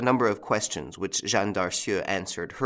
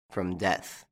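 very distinct. A narrator's reading. Lupin warns the girl of danger, very distinctly. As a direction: give each word its own weight. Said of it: "in a very distinct voice".

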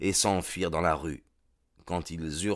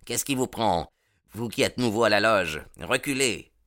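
mild angry retort; 4 semitones higher than usual. Acted. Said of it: "cria-t-il".

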